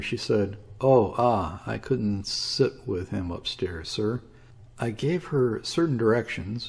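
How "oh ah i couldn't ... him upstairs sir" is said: usual speaking voice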